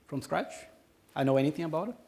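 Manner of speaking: normal talking voice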